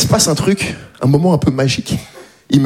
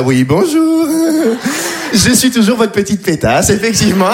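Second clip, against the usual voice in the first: higher, sweet polite voice; polite little voice; 3 semitones higher than usual. Retold or imitated. Comedian answering his banker in a high, polite voice. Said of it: "cette petite voix un peu plus aiguë".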